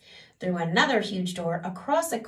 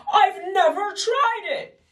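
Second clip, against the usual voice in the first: tearful king; 12 semitones higher, 6 dB louder, slower than usual. Acted. Sniffling king continues.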